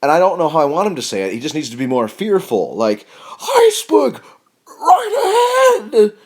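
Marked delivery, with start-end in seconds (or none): 3.4-6.1 terrified cry, much higher, louder, stretched out slow; petrified. Pretend. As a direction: shriek the warning in terror. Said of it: "That's, like, petrified".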